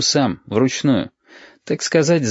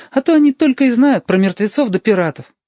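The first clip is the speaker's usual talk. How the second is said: put-on high child's voice, complaining about the others; high girlish complaint; 12 semitones higher, 3 dB louder than usual. A narrator's reading. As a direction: pitch it up like a little girl telling on friends. Girl complains about the boys' stories.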